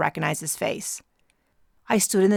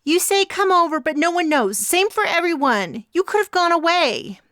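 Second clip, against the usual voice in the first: much higher, loud and strained; high and tense; 11 semitones higher, 6 dB louder than usual. A narrator's reading. Glasses defending herself, high and tense. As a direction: pitch it up, tight with nerves. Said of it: "her voice unnaturally high and tense".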